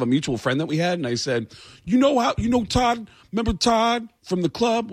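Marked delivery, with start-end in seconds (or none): 1.8-4.9 high put-on voice, chatty and menacing.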